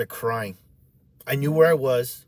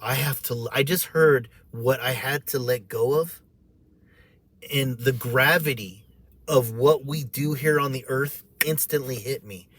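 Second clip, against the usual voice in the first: normal talking voice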